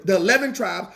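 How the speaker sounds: ordinary talk, nothing marked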